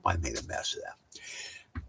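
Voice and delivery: soft, half-whispered, slow admission; muttered self-rebuke. Real, not acted. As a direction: mumble the confession to yourself.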